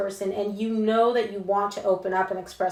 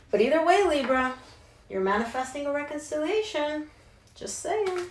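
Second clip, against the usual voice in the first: teasing sing-song; 6 semitones higher than usual. Real, not acted. Teasing emphasis on the message.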